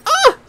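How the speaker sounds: imitating her sudden little shriek; startled cry. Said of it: "Now she went".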